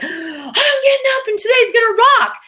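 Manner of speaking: a wife leaping out of bed, thrilled about the day; excited wife. Retold or imitated. Imitating an eager wife who wants to thrive, high and excited. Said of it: "wife that's like".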